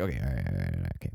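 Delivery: low mumbled self-reassurance; low, running string of grudging agreement; resigned self-talk. Retold or imitated. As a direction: drop low and mutter to yourself. Talking himself into a plan.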